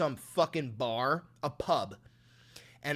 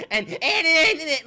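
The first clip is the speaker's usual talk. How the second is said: mock stammer; 12 semitones higher, 9 dB louder than usual. Stammering parody of press secretary.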